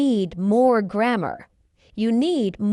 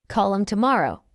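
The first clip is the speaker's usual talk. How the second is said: quick, linked "Call 'em tomorrow"; fast English; much faster than usual. Shown as a demonstration. Teacher trains learners' ears, fast. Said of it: "fast English is not difficult".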